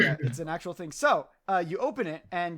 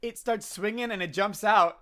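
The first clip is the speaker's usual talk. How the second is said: ordinary talk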